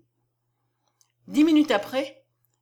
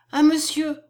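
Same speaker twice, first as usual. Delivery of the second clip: higher, louder exclamation; raised voice, appealing to him; exclaiming; 5 semitones higher, 5 dB louder than usual. A narrator's reading. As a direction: cry out in appeal. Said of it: "s'écria la belle madame Séchard".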